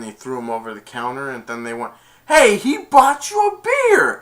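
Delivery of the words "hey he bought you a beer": high, loud and slow; squealing high yell of protest; retold yell. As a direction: shout it across the bar in outrage. Crowd yells during the fight.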